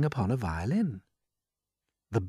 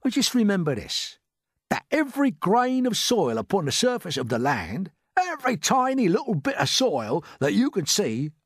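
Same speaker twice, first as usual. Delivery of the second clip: higher, boastful put-on voice; proud earthworm; 6 semitones higher, 4 dB louder than usual. A narrator's reading. Earthworm boasting to James about soil, proudly high. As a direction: marvel grandly at your own work.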